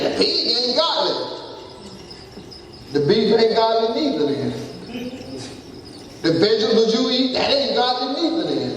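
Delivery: high, insistent preacher's pitch; impassioned preaching. Real, not acted. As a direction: drive the point home to the congregation, pitched high. Preaches at full force.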